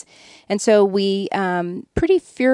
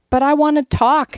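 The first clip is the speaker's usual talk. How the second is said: retold whiny plea, pitched up; mock-pleading, higher in pitch; plaintive protest; 6 semitones higher, 4 dB louder than usual. Retelling complaint to inattentive husband.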